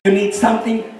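usual speaking voice

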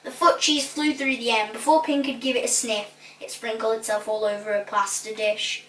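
ordinary delivery

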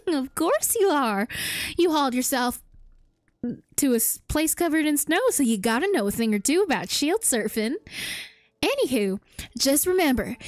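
folksy put-on southern voice; southern accent. Villager teaches the hero shield surfing, southern accent. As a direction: explain it as a southern villager would. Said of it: "I started doing a southern accent".

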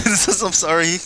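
giggling sorry; laughing apology. Real, not acted. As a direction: apologise while cracking up.

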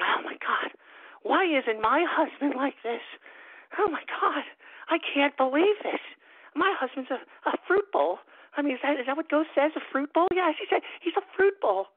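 high, put-on woman's voice; mock mother's voice. A host imitating a listener's mother lamenting her husband, high-pitched mock voice. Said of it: "your mother is going out and saying".

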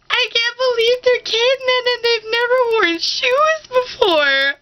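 high, tearful, wobbly crying voice; mock bawling. Watching The Croods with family, mock bawling. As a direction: sob through the words like a movie wrecked you. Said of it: "bawling at every five minutes".

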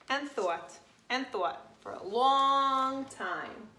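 usual speaking voice

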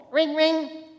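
high sing-song "Ring, ring"; phone ring. Retold or imitated. Preacher imitating the phone ringing, high sing-song.

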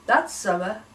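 plain reading voice

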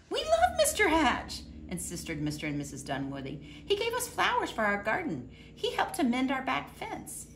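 a bright, high neighbour speaking up for him; neighbours' voice. Acted. Mister and Missus Dunwoody insisting they love him, high and fond.

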